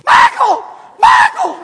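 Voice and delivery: very high, very loud shrieked calls; wife outdoing the fan for the jersey, shrieking; Missus Weaver shrieking. Retold or imitated. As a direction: scream his name with everything you've got. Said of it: "Missus Weaver goes".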